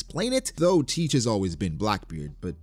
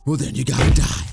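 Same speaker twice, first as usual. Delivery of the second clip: loud, hammed-up death threat; mock threat; 3 semitones higher, 8 dB louder than usual. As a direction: burst out louder as a playful villain. Acted threat answering the refusal.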